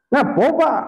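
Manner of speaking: high, startled ask; a shopkeeper surprised at the panting man; mild mock surprise; surprised question. Stall owner asks surprised.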